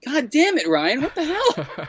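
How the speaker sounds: very high laughing mock curse; half-laughing squeal of betrayal; mock exasperation. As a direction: shriek it in playful outrage. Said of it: "And I was like".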